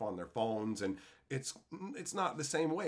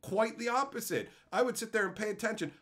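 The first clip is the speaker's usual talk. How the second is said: usual speaking voice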